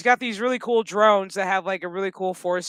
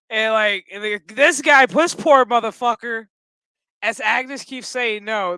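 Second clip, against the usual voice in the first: ordinary talk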